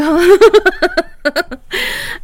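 real giggling burst; laughing. Real, not acted. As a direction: break into a run of giggles. Amused remark to Kelly.

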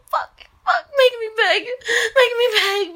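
normal talking voice

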